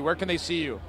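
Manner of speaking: usual speaking voice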